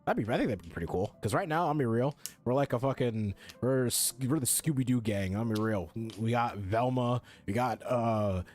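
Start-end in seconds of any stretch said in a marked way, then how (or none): none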